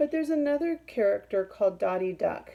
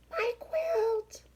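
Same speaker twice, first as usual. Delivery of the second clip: much higher, urgent cry. Acted. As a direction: cry out for the stolen quilt. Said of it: "cries Quincy".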